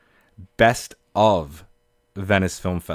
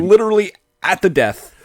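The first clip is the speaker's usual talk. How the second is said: high, loud excited cry; excited shouting; 10 semitones higher, 5 dB louder than usual. Real, not acted. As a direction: call it out in triumph. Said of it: "Sorry for the screaming".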